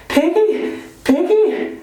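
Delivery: high-pitched teasing call, mock shriek; gleeful shriek. A narrator's reading. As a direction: jeer the nickname in a high, delighted voice. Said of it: "Ralph shrieked with laughter".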